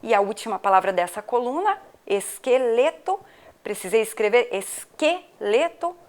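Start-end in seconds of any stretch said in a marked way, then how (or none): 2.0-3.2 esqueleto slowly broken into syllables. Shown as a demonstration. Sounding out word for spelling. 4.5-6.0 esqueleto in separated syllables, QUE set apart. Shown as a demonstration. Isolating the syllable que in spelling.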